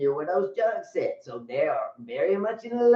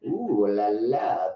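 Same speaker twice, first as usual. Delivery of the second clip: low, slow, purring ooh la la; mock French lover purr; Pepe Le Patrick; 10 semitones lower, much slower than usual. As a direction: purr it like a cartoon French skunk. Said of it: "Pepe Le Patrick".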